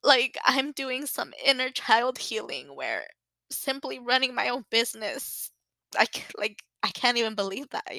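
getting emotional. Real, not acted. Telling listeners about her own business, holding back real tears. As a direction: fight back real tears as you talk. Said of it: "I don't mean to get emotional".

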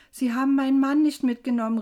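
loud, high, fast outcry; aufgebrachtes Rufen. A narrator's reading. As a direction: call out in hurried distress. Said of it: "rief ich". (lauter, aufgebrachter Ausruf)

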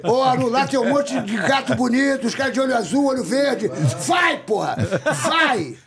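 high-pitched, playful pestering; hammed-up excited coaxing; mock urging. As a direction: egg a friend on, building to a yelled command. Jokingly urging Aru to go.

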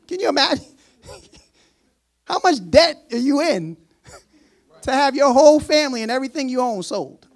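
incredulous. Real, not acted. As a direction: hammer how absurd the debt is. Preacher incredulous at huge debt.

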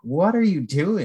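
high, drawn-out disbelieving question; mock incredulity. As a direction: act out catching yourself being a weirdo. Acts out embarrassed self-reproach.

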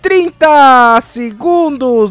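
slow, stretched, loud cry; drawn-out excited call. Real, not acted. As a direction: drag the words out with excitement. Counting down to Christmas.

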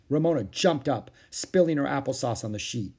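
ordinary delivery, nothing marked

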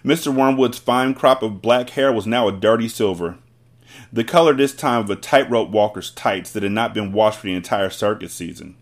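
ordinary delivery

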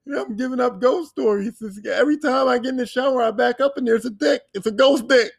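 high-pitched, hammed-up panic; mock distress. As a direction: wail it like a put-upon ghost victim. Acting out frightened ghost victim's complaint.

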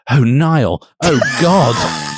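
high, hammed-up cry; theatrical scream without full volume; mock scream. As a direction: overdo the ecstatic outcry. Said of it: "I screamed".

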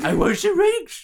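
high, slow mock voice; silly put-on voice. Teasing her co-host in a silly high voice. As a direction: squeak it out like a silly character.

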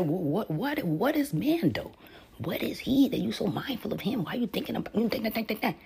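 high-pitched mock protest from the angels; incredulous questioning. Mimics angels questioning God's plan.

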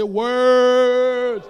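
very loud, long-drawn WORDS; bold. Shown as a demonstration. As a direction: belt it out boldly to rouse the congregation. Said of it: "Come on, say that boldly".

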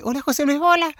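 put-on high, genteel old lady's greeting; friendly old boss. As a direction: greet the child in a high, affected voice. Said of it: "la vieja bien simpática, hablaba como así". (voz aguda de vieja simpática)

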